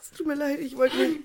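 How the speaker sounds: crying apology; tearful apology. Real, not acted. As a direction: plead sorry through tears.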